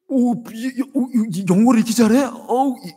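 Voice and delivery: hammed-up wow of schoolkids; children gasping at English; kids amazed. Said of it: "뭐 이렇게 된 거예요".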